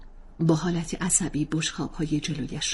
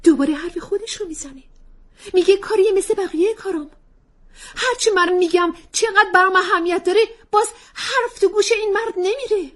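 much higher, louder, tearful at end; exasperated complaint; 9 dB louder than usual. Acted. Wife complaining to child about husband, exasperated. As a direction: vent to the child in frustration.

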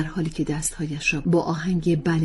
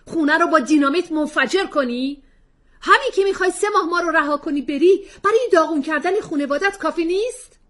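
sarcastic retort; 13 semitones higher, 9 dB louder than usual. Acted. Husband retorting to wife, angry sarcasm. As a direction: throw her words back.

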